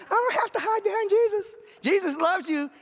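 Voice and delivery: very high-pitched, insistent declaration; voice shooting up. Real, not acted. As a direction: let the voice leap up high as you insist. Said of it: "See how my voice went up?".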